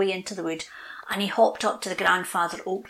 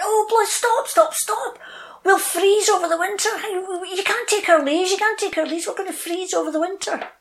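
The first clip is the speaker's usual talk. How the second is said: much higher, louder crying plea; panicked trees; 11 semitones higher, 5 dB louder than usual. Acted. Bare trees crying out to the north wind in panic. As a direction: beg the wind to stop, frantic.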